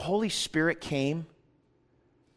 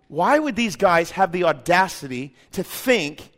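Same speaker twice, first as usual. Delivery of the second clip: ordinary delivery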